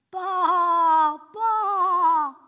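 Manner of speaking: loud, high bleating "baa"; sheep bleat. A narrator's reading. Trapped sheep calling out to its shepherd, a loud bleat. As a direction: bleat like a frightened lost sheep.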